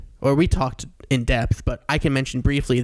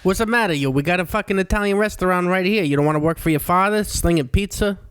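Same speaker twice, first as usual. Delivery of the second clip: higher-pitched Long Island Italian-American voice; Long Island accent; 4 semitones higher than usual. Acted. Playing Long Island relative pushing the family pizza restaurant, Long Island accent. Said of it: "In that Long Island voice".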